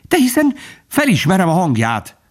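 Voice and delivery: very high, desperate exclamation; despairing protest. A narrator's reading. Bambi insists it is Faline's voice, despairing. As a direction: protest desperately, pitch leaping up. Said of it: "Bambit kétségbeesés fogta el".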